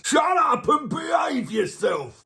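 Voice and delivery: high, loud, slow yell; angry shout. Retold or imitated. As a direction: yell it like a furious parent. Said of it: "All this song is actually saying is".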